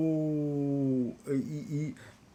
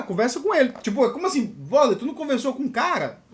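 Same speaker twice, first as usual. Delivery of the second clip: loud, higher mock exasperation; incredulous scolding; 6 semitones higher, 6 dB louder than usual. Colleagues asking if he even talked to the guy, exasperated scolding. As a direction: imitate colleagues telling him off, pitched up.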